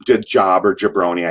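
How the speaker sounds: normal talking voice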